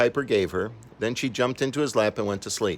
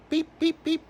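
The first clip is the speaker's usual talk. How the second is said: very high tiny peeps; chick beeping. Acted. Chick pecks the bus driver's finger, high peeps. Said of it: "it cried".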